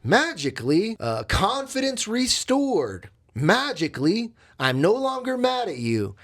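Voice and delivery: sarcastic. Acted. Speaker mocking his restored confidence, sarcastic. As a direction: announce it with ironic delight. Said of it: "I'm being sarcastic the way I'm saying it".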